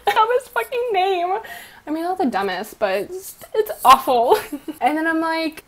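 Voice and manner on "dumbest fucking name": high, squeezed out through laughter; laughing. Real, not acted. Vlogger embarrassed by the game's name, laughing. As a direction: laugh helplessly through the words.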